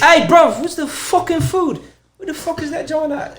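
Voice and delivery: high loud London roadman voice; London accent. Retold or imitated. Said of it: "they call drugs food".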